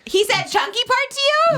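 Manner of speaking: high-pitched, real incredulous question. Real, not acted. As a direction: shoot the pitch way up in disbelief. Shocked at the retold insult.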